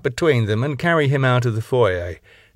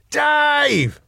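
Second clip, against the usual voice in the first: shout at the top of his lungs. A narrator's reading. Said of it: "At the top of his lungs".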